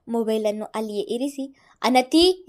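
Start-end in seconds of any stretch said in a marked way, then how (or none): 1.8-2.4 loud call for the daughter. A narrator's reading. Father calling for daughter, loud. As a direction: call her name across the house. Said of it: "ಎಂದು ಕೂಗುತ್ತಾ".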